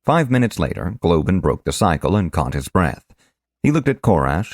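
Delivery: plain reading voice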